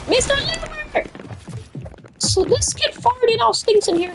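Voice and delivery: high, squealed mock complaint; mad girls. Girls complaining to the teacher about a fart, mock outrage. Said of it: "the girls were so mad".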